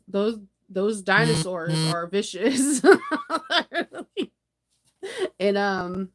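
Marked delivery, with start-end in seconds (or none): 2.9-4.2 high laugh. Real, not acted. Laughing at vicious dinosaurs.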